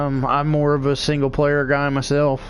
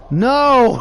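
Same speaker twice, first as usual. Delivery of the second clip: louder, higher blunt no; flat refusal; 9 semitones higher, 6 dB louder than usual. Retold or imitated. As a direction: shut the friend down hard. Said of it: "And he's like".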